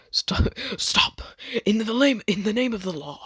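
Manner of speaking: high, theatrical cry to halt; mock heroic call. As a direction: call it out like a hammy lawman. Acts out Naruto intervening dramatically.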